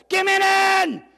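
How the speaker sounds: high, loud cheerleader yell; yelled cheer. Retold or imitated. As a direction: belt it out at full volume. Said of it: "get to yell at an admiral".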